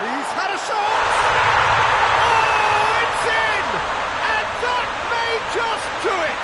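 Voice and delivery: very high commentary, exploding loud at the goal; ecstatic goal call. Real, not acted. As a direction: erupt over a last-gasp winner. Late goal is called.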